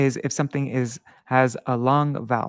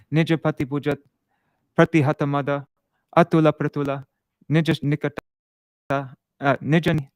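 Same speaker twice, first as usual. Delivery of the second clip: Nija pati puja chanted as quick, even short syllables; 3 dB louder than usual. Shown as a demonstration. Teacher showing students the quick short syllables in recitation. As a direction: rattle off each short syllable fast and light. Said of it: "we're gonna go".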